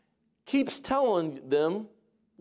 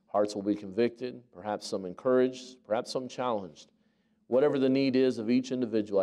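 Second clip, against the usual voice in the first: prayer; 7 semitones lower than usual. Acted. Pastor praying before the sermon, hushed and reverent.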